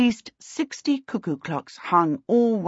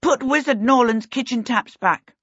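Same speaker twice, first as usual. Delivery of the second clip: higher, ready answer; prompt; 7 semitones higher, 3 dB louder than usual. A narrator's reading. Peter answers Timmins's question about gratitude, promptly. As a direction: answer straight away, decisively. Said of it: "Peter said promptly".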